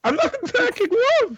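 very high-pitched squeal of protest. Real, not acted. Friend accused of attacking love, squealed denial.

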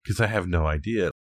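ordinary delivery

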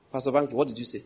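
ordinary talk

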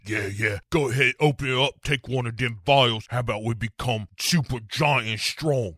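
fast, coaxing thug voice; sly goading villain; tempting Hyde. Retold or imitated. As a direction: egg him on like a devil on the shoulder. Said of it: "Edward Hyde is like".

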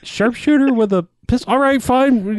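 high-pitched mock disbelief; incredulous giving-in. Officials doubt the sharpshooter's pistol, mock incredulous concession. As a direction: question it, then concede in exasperation.

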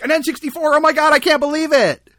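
put-on high, loud squealing kid; kid on Christmas morning. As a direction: shriek like a kid unwrapping a gift. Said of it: "he sounds like a little kid on Christmas morning".

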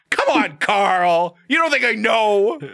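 high, slow, drawn-out sass; exasperated diva Dracula; sassy modern Dracula. Retold or imitated. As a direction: whine it like a diva. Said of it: "It was like".

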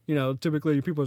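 ordinary delivery

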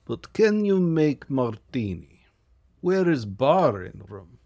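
lightly put-on Russian accent, even and unhurried; a rich Russian client speaking clipped broken English. Russian client demanding a bar from the agent, in a Russian accent.

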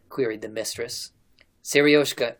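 plain reading voice

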